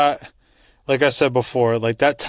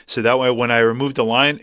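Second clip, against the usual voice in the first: normal talking voice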